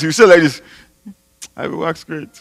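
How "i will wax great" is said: much softer, half-hearted, with no conviction at all; weak timid declaration. Shown as a demonstration. Pastor showing the congregation the wrong, timid way, soft and limp. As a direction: mumble it like you don't believe a word.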